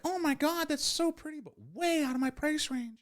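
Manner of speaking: high breathy-sounding customer impression, admiring but broke; gushing customer. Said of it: "and they're like".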